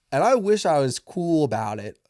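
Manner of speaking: normal talking voice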